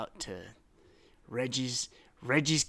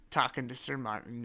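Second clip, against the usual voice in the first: usual speaking voice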